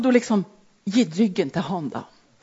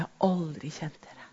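hushed Jesus; 4 semitones lower, 10 dB quieter than usual. Acted. Jesus rejecting false healers.

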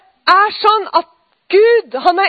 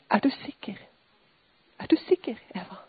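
hushed voice of God; 7 semitones lower, 10 dB quieter than usual. Retold or imitated. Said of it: "Nå begynner jeg å hviske".

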